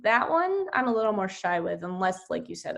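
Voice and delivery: usual speaking voice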